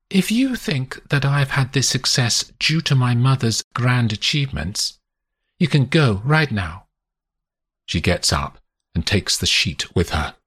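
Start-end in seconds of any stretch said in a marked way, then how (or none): 0.1-6.9 higher woman's voice, an ultimatum; a hint of a furious lover; a woman ordering her lover out; Constance, cold anger.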